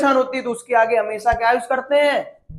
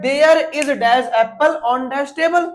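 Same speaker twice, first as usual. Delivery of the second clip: slow reading; much slower than usual. Shown as a demonstration. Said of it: "reads the exercise sentence aloud".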